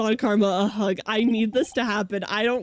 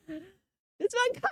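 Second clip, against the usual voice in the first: put-on much higher, softer little girl chirp; child happily greeting the prosecutor; cute Pearl greeting; 10 semitones higher, 4 dB quieter, much slower than usual. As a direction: greet her sweetly like a child. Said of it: "And Pearl's like".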